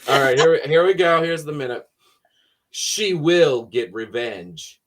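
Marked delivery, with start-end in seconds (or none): 2.7-4.8 announcer voice. Acted. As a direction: narrate it like a trailer announcer. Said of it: "I also don't have Jason announcer voice".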